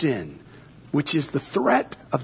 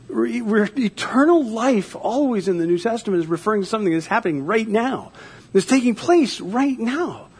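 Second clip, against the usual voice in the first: raised, fervent sermon voice; preaching; 7 semitones higher, 4 dB louder than usual. Acted. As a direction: preach it with rising conviction. Preaches on new life.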